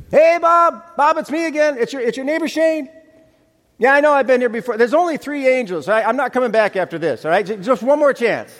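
high, bright pushy salesman cheer; high eager neighbor pleading at the door; overeager neighbor. Acted. Preacher acts pushy cheerful neighbor.

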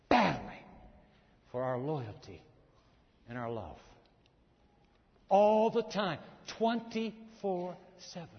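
normal talking voice

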